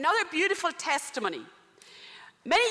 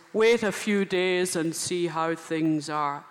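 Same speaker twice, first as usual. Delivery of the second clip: slow, lower churchman's caution; a deeper, slower cardinal voice; cautious cardinal; 8 semitones lower, slower than usual. Retold or imitated. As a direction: drawl it out like a wary cardinal.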